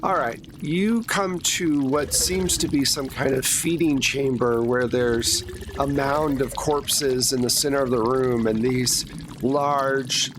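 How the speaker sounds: ordinary talk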